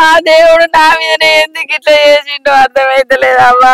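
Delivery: crying mother's sing-song keening; tearful lament. Acted. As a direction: lament through sobs, stretching the line endings into a wail. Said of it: "ఎందుకు ఎడుతున్నావ్".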